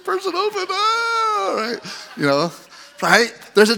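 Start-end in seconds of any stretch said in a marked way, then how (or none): 0.7-1.5 high mock wailing cry; hammed-up sobbing wail; emotional wail. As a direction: wail it like a drama queen.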